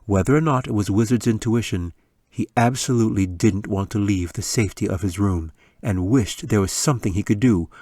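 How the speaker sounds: plain reading voice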